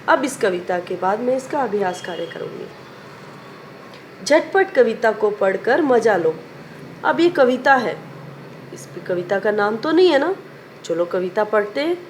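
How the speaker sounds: ordinary delivery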